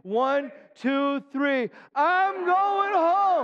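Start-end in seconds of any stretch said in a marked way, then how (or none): 1.9-3.4 higher, very slow drawn-out call; preached refrain. Shown as a demonstration. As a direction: stretch it out like a revival shout. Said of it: "say it with me on the count of three".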